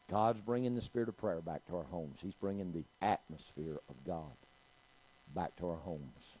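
ordinary talk, nothing marked

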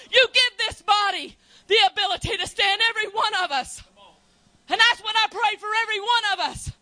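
loud, rising sermon cry; full-force preaching. Real, not acted.

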